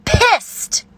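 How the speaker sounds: sudden high furious shout. Real, not acted. Angry vlogger shouts about being pissed, furious. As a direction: spit the word out in rage. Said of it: "I'm fucking".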